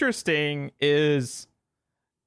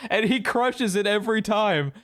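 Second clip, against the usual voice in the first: ordinary talk, nothing marked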